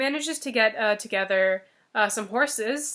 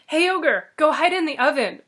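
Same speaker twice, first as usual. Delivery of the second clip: louder, higher bright command; calling out; 4 semitones higher, 6 dB louder than usual. Acted. Fox telling the ogre to hide in the oven, loud. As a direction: call him over and point him in.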